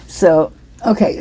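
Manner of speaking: usual speaking voice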